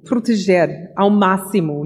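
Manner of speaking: usual speaking voice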